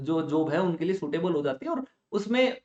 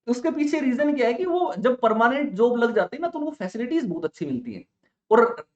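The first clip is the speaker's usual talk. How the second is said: normal talking voice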